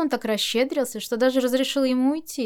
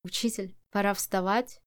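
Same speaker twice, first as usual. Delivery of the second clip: hushed, slightly lowered wake-up call; 3 dB quieter than usual. A narrator's reading. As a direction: drop your voice to wake him. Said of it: "позвать его более низким голосом". (приглушённый, пониженный голос)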